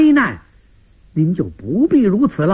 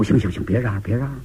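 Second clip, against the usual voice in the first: low, soft hushing of noisy sons; 8 semitones lower, 5 dB quieter, faster than usual. Acted. Old father hushing his shouting sons, low and soft. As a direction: wave them down gently, voice low. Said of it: "老头儿赶忙摆摆手".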